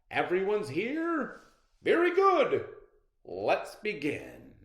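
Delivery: high, loud cheery host; rally the contestants; host calling out. Acted.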